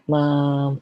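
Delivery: ordinary talk, nothing marked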